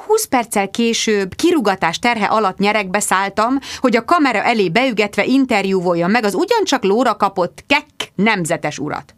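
usual speaking voice

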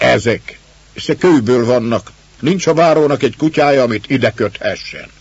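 a quick, high-pitched jeer at the lions; scornful retort. Acted. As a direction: brush off the stone lions with scorn. Girl scoffs at the stone lions.

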